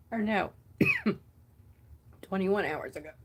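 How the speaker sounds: coughing, choking on water; words broken by choking coughs; choking on a drink. Real, not acted. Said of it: "Now I'm choking on water".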